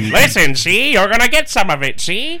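high, loud movie-gangster impression; hammed-up old-movie tough guy; James Cagney. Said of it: "You want James Cagney?".

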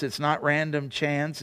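normal talking voice

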